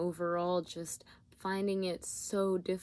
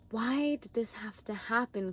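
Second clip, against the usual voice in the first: resentful; 3 semitones higher than usual. Retold or imitated. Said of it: "kind of energy".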